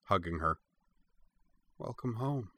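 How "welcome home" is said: much softer, tender hush; tender hushed welcome; softly. A narrator's reading. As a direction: murmur it into the puppy's fur. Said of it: "said Cora softly".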